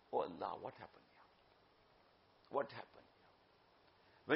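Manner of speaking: low, hushed, drawn-out lament to Allah; retold crying lament. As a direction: imitate a weeping man, very slow and soft. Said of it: "He began to cry".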